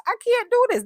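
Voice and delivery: very high, loud acted protest; mock exasperated outburst. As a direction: blow up like someone fed up. Repeats it as dramatic complaint.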